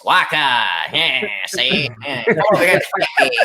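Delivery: mock Italian tough guy; hammy Italian accent. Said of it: "that Italian voice".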